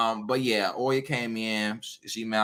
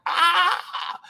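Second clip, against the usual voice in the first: very high mock shriek; mock scream; 4 dB louder than usual. An angry orisha reacting, mock shriek. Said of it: "It's not gonna be like".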